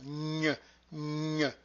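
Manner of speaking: "Gn" as a held palatal nasal, like ny; high nasal sound. Shown as a demonstration. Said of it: "it is a very high nasal".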